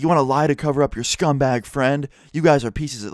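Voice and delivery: retold angry tirade; imitated angry confrontation. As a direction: imitate a dad getting in someone's face. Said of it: "gets in my friend's face".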